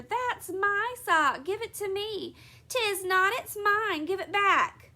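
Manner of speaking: put-on high, loud squabbling kids; quarrelling children. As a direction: fight over the sock like a child. Twins quarrel over a sock.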